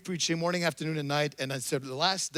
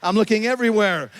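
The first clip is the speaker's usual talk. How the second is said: ordinary talk, nothing marked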